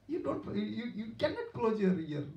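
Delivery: usual speaking voice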